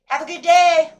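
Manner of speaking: breezy mock farewell from an ungrateful passenger; mock breezy goodbye. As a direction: toss it off like someone who never says thanks. Mocking ungrateful friend's brush-off.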